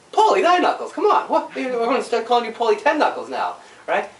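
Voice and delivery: high, loud, laughing ribbing; laughing tease. Retold or imitated. Teasing father-in-law at the dump, high laughing ribbing. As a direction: tease him through a laugh.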